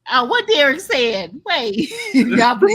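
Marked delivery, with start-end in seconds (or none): 2.0-2.8 high, laughing words; laughing at a friend's comment. Real, not acted. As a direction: talk through a laugh. Laughing at Derek.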